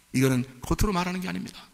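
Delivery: ordinary delivery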